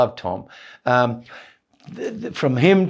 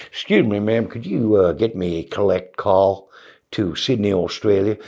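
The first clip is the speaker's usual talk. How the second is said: put-on lower, polite Southern US accent; put-on American accent; 6 semitones lower than usual. Comic asking the operator for a collect call, American accent. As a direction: imitate a courteous American caller. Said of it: "the accent to these guys".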